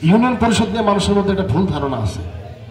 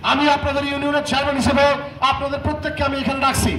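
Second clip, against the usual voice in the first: higher, formal public address; pompous mayor; 4 semitones higher than usual. Acted. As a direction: orate to the crowd like a self-important chairman. Chairman addresses the villagers.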